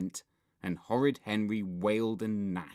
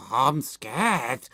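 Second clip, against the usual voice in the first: put-on frightened, wailing boy; Anxious Andrew wail; 3 semitones higher, 5 dB louder than usual. A narrator's reading. Nervous boy as film starts, frightened wail. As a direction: wail it loudly in fear. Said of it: "wailed anxious Andrew".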